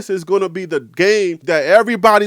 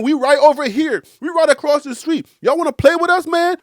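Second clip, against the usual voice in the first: high, worked-up challenge; indignant rival team; 8 semitones higher, 4 dB louder than usual. Acted. Overlooked Rams calling out rivals, mock indignant.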